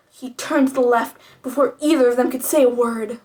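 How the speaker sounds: plain reading voice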